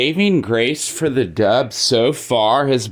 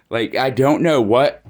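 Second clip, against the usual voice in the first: normal talking voice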